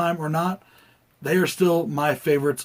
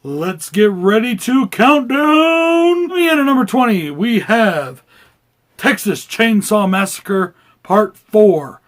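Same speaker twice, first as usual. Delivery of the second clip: announcer voice; 5 semitones higher, 7 dB louder than usual. Acted. Announcer voice held.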